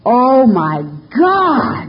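hammed-up shock of the officer; stunned cop. Cop realising the driver is a woman, drawn-out amazement. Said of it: "this guy was like".

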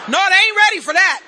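loud emphatic shout. Real, not acted. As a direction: hammer the punchline home to the crowd. Punchline to the AA crowd.

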